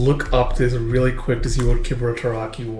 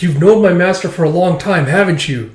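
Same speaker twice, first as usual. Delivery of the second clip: insistent pressing; 5 semitones higher, 5 dB louder than usual. Acted. Acting out Akane pressing master.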